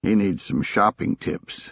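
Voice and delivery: ordinary delivery, nothing marked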